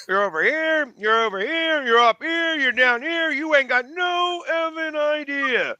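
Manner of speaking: high-pitched mock rant at an umpire; Schwarber. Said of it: "the whole dance by Schwarber".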